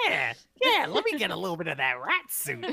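high, squeaky little rodent; rat voice. Pretend. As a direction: play a scheming rat. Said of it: "My rat voice".

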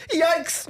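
high sudden yikes of pain; mock pained yelp. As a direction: imitate a sharp jolt of pain. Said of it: "they just go".

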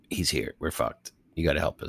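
low, soft imitation of a character; hushed retold plea. Character pleads with newly arrived Obi-Wan for help, low and hushed. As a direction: drop right down, confiding quietly.

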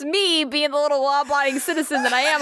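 ordinary delivery, nothing marked

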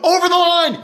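high loud mock bellow; mock yell. Walter yelling at a bowler who stepped over, high shout. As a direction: scream the foul call at a cheater.

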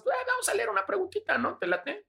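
playful host voice. Acted. Host voice to read question.

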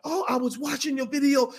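excited fan. Retold or imitated. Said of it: "She's like".

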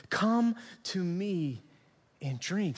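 drawn-out words with a pause before "and drink"; slow, deliberate invitation. Retold or imitated. Preacher repeating Jesus's words to the congregation, slow and deliberate. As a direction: stretch out "Come to me", giving each word weight.

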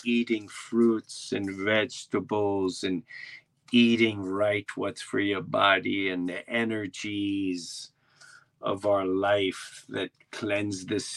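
slow, even recital in a daze; dazed tour guide. Retold or imitated. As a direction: keep reciting slowly, addressing no one. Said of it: "he just said it in a daze".

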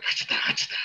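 whispered ringtone imitation; breathy imitated anime ringtone; whispered sound effect. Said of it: "every day I hear it and it's like".

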